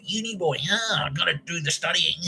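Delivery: high sneering mock voice; mocking taunt. Housemates mocking the student who won't drink, high and sneering. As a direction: taunt the uni boy.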